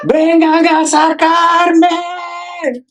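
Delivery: very high holler, long-held last word; imitated childhood yell. Child in a crib calling to be taken out, imitated yell. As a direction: holler slowly for someone to come get you. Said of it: "you would yell out".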